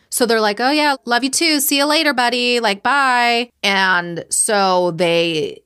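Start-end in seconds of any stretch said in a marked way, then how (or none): 0.6-3.5 high, sing-out fake goodbye; mock casual friendliness. Couple hiding their alarm from Sam, mock cheerful. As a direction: imitate a cheery cover-up farewell. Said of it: "they can't let on".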